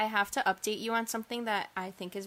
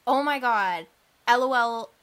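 loud, spelled out letter by letter; saying LOL aloud; 3 semitones higher, 11 dB louder than usual. Shown as a demonstration. Showing how to say text slang aloud, loud. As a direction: announce L O L out loud for a laugh. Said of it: "out loud".

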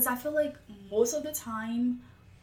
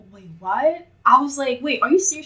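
louder, incredulous "Wait, what?"; retold disbelief; 3 semitones higher, 8 dB louder than usual. Reacting to her classmate needing help, surprised. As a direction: act out a double take. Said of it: "I'm like".

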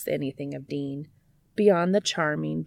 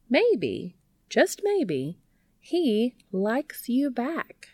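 higher, drawn-out slow reveal; teasing build-up; 8 semitones higher, much slower than usual. A narrator's reading. Charlie suggesting Cass likes Dean back, high and slow. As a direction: tease it out, then land it slowly. Charlie offers third theory.

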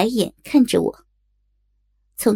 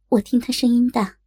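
plain reading voice

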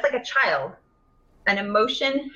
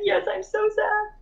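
put-on much higher, slow pouty kid voice; sad child; 11 semitones higher, slower than usual. A little girl answers mom, slow, high and pouty. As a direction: drag it out like a sad toddler.

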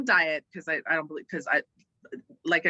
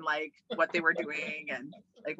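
usual speaking voice

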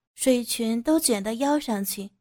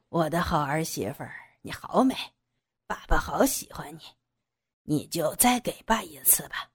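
low, menacing father-in-law voice after a chuckle; leering villain; 5 semitones lower than usual. Acted. Father-in-law ignores daughter-in-law's pleas, leering villain. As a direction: drop low and coax with a sinister leer. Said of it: "他淫笑着".

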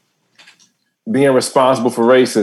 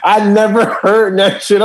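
high, laughing disbelief; can't believe it, cracking up; laughing while retelling; tickled disbelief; 6 semitones higher, slower than usual. Real, not acted.

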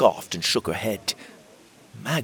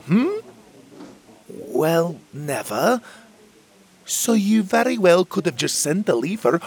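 high, loud, doubting voice for the chubby fairy; stern fairy Magborn; 10 semitones higher, 5 dB louder than usual. Acted. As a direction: needle him with pointed questions. Magborn questions Will's spell.

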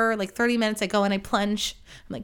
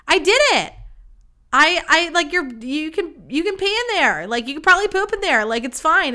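higher, louder mock victory cry; triumphant announcement; 5 semitones higher, 3 dB louder than usual. Boyfriend bursting out after fixing her toilet, triumphant. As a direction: act out a proud, excited boyfriend.

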